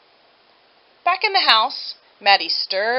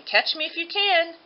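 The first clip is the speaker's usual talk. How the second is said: lightly voiced gingerbread baby, pitched up; a playful taunt tossed over the shoulder; taunting shout; 7 semitones higher than usual. Gingerbread baby taunting everyone from the yard, teasing. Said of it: "the gingerbread baby shouting".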